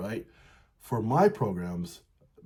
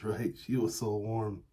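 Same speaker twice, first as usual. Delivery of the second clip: ordinary talk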